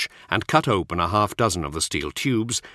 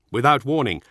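plain reading voice

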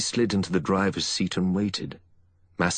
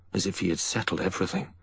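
ordinary delivery, nothing marked

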